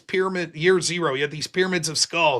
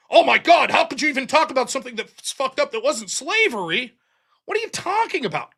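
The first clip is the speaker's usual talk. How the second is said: mock outrage; 7 semitones higher than usual.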